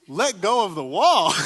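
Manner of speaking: incredulous. Retold or imitated. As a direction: repeat it in shocked disbelief. Kid incredulous at dad's advice.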